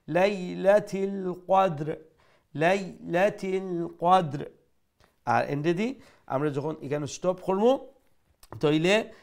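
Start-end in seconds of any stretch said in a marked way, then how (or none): 0.1-4.5 slow লাইলাতিল কদর with short vowel; short vowel demo. Shown as a demonstration. Teacher drills short vowel for students. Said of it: "খাটো করে উচ্চারণ করবো".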